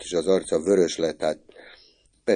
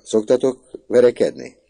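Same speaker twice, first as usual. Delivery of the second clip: much higher and louder question; pointed question; 8 semitones higher, 10 dB louder than usual. A narrator's reading. Woman quizzing her son's little schoolmate, pointed question. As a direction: put the question suddenly, high and loud.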